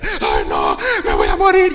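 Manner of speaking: high, loud hammed-up wail of fear; imitating a frightened believer shrieking; mock panic. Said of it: "es el que más se paniquea".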